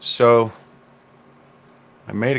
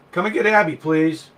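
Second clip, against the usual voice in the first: calling out; 8 semitones higher than usual. Real, not acted. Calling someone to catch the dog.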